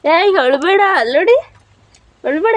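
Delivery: high-pitched, insistent coaxing; coaxing fuss. Real, not acted. Wife urging her crying husband to stop, coaxing fuss.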